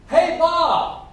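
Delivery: high, loud call from another room. Acted. Girlfriend calls Bob from the bathroom, loud high holler. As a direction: holler to get his attention.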